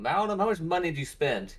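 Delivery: loud call across a room; yelling across the room. Acted. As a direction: shout it to someone far away. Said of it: "I'll yell across the room".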